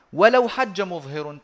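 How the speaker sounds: slow, lifted chant of the verse; measured chanted recitation of a verse; poem recitation. Shown as a demonstration. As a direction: intone the line like a recited poem.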